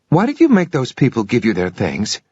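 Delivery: put-on puzzled child-like voice; confused girl. A narrator's reading. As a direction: ask it confused and innocent. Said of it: "She sounded confused".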